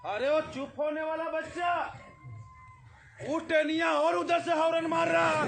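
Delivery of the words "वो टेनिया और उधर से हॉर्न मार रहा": excited loud telling. A narrator's reading. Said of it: "बड़ी जोर जोर से".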